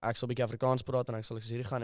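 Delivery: native Afrikaans, spoken casually; conversational South African Afrikaans; fluent Afrikaans. Shown as a demonstration. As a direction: switch easily into Afrikaans. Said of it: "I'm speaking Afrikaans".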